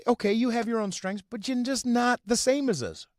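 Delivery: high, loud sneering mimicry; mock-patronizing singsong; mocking imitation. Mocking what people say to him.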